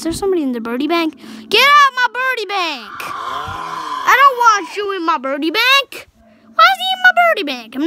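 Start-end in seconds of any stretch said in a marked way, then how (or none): 1.5-7.8 outraged yell. Real, not acted. Intruder in his base.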